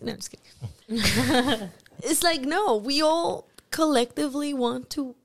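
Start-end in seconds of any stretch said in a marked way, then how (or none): none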